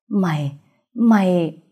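effortful last words; straining dying voice. A narrator's reading. Shot man struggles to speak before dying, straining. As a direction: force each word out with failing strength. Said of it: "gắng gượng phát ra âm thanh".

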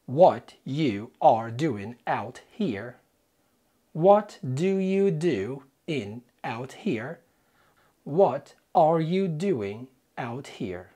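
slow word-by-word read; very slow dictation. Shown as a demonstration. Teacher spelling out the answer slowly for learners. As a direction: stretch each word far apart. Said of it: "What did you hear".

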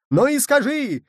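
high, loud, heated yell; yelled demand. A narrator's reading. Nicholl demands an answer from Michel, high yell. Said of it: "завопил Николь".

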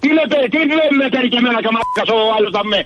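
angry outburst at a prank caller; exasperated rant. Real, not acted. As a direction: sputter it out in disbelief and rage. Prank victim loses temper.

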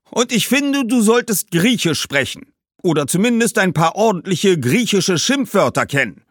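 high, loud, cheeky suggestion; Greek merchant ghost. A narrator's reading. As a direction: push Greek with a wink. Greek ghost gives his opinion. (hoch, laut und frech vorschlagender Kaufmannsgeist)

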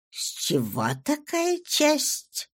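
calm, measured noblewoman; cool baroness. A narrator's reading. Baroness voiced, low, slow.

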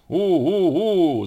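high, long "oo" motor noise; engine hooting. A narrator's reading. Donkey sitting on the wooden plane, hooting as its motor. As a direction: hoot high like a whirring engine. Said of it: "загудел ослик".

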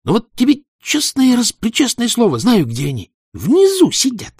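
put-on high loud fervent boy; thin eager boy. Thin boy swears loudly.

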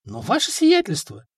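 high, squeaky objection; squeaked protest. A narrator's reading. Rhetor objecting to the prince's order, squeaking in protest. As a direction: squeak up at your superior in protest. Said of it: "пискнул ритор Леонтий".

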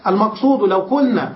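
ordinary talk

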